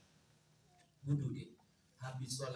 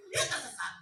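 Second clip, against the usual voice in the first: ordinary delivery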